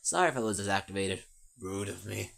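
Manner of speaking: low, odd-timbred machine voice with a pirate twang. Acted. Foxy the bot greets Francesca back, low robotic drone. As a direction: speak as a droning robot fox calling people matey.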